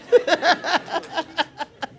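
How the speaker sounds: high, stuttering giggle; giddy giggle. Acted. Singer acting out meeting her idol, high starstruck giggle. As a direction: let out a high, staccato titter.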